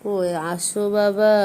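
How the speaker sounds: slow, drawn-out tender call; gentle coaxing. Real, not acted. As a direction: stretch the words to lure him in. Coaxing crying child into lap.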